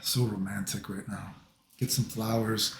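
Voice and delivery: soft, low, playful romantic murmur; mock romantic. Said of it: "I'm gonna make this sound, like, romantic".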